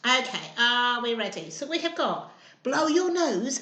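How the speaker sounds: ordinary delivery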